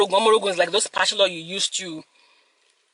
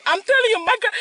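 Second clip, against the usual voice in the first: high, through laughter; laughing; 12 semitones higher than usual. Real, not acted. Telling viewers about grandma, laughing. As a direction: squeeze it out while laughing.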